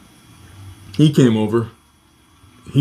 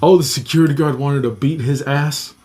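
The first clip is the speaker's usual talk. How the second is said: ordinary delivery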